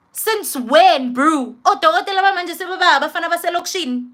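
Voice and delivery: sarcastic outburst at a careless doctor; indignant disbelief. Retold or imitated. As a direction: fire back in disbelief, voice jumping up.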